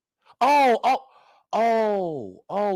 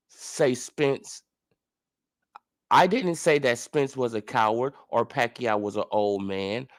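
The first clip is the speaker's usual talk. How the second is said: mocking impression of Ugas; 9 semitones lower than usual. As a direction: drag it out in a low, pompous mock-humble tone. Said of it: "let's read it again".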